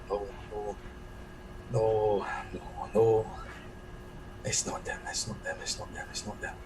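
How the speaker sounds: hushed mumble sinking into a whisper; mumbling to himself. Acted. Player's character waking from a nightmare, mumbling to himself. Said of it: "just kinda mumble to himself".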